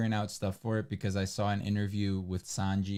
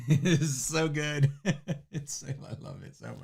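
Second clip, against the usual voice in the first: giggling through the words; laughing; 6 semitones higher than usual. Real, not acted. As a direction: laugh while gushing about it. Laughing about Zuko scene.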